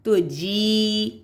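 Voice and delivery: جی with the ee drawn out slowly; جی held long. Shown as a demonstration. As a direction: stretch the ee in جی. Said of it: "یا بھی ہے".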